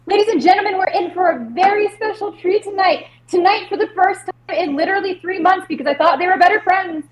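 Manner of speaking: high, showy emcee call; stage announcer. Acted. Performer calling out to the audience, showy announcer. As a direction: hype the crowd from the stage. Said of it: "I call out to the audience".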